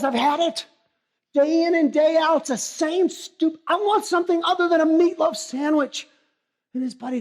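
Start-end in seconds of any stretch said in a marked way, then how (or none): 0.0-6.1 higher and louder, boiling over; fed-up rant. Acted. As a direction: blow up about the same lunch. Worker rants about lunch again.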